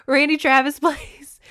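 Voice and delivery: laughing repeat. Shown as a demonstration. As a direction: repeat it again, cracking up with excitement.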